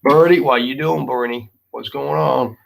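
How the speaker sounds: ordinary talk, nothing marked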